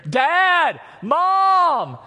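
put-on high, loud child call; kids calling out. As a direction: yell for the parents from far away. Kids calling from across house.

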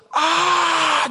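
high, loud imitated yell of a desperate father; imitated scream. Pastor acting out the dad's sacrificial scream, high and loud. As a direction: belt out a scream to draw the monsters. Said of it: "belts out this".

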